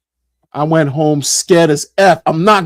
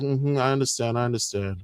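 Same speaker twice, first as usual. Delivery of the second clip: soft, hushed acknowledgement; soothing supervisor; 2 semitones lower, 10 dB quieter than usual. Retold or imitated. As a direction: humour a crying caller, much softer. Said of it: "And then she's like".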